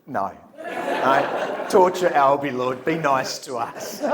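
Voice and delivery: laughing protest. Retold or imitated. The group answering Albie's prayer, protesting through laughter. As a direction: plead back while laughing. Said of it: "And we go".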